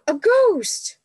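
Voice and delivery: high, loud frightened cry; cried out. Acted. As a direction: cry out as if seeing a ghost. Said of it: "cried Sam".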